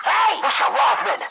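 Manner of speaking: loud, high shout across the woods; calling out. Acted. Calling to Rothman during the chase, loud shout. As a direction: shout to get his attention.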